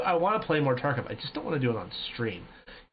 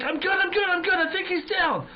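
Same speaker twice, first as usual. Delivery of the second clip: panicked yell. Retold or imitated. Telling teammate he is fine after the shot, frantic high yell. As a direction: gasp it out, still in shock.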